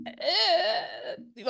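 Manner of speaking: high, hesitant "uhh"; doubtful drawn-out groan. Real, not acted. Friend says everyone loves Taylor, doubtful high groan. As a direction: stretch out a high noise of doubt.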